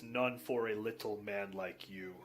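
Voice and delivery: low, quiet orc put-down; mocking orc. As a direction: talk down to the little man like a gruff orc. Orc mocks little man.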